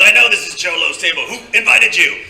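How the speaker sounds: loud, imitated security challenge; challenging guard. Security guard challenging the newcomer at the table, loud demand. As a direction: raise the voice and demand it.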